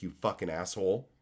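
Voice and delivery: ordinary talk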